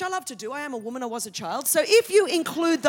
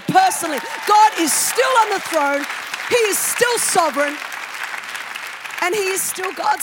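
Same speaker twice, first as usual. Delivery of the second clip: preaching at full force; 2 semitones higher, 7 dB louder than usual. Real, not acted. Preacher declaring to the congregation that God still reigns, loud and firm. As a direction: proclaim it to the whole room, full voice.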